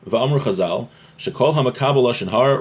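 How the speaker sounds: ordinary talk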